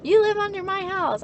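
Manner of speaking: high mocking parent lecture. Parents lecturing her, high mocking voice. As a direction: parrot the parent scornfully. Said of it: "fucking parents".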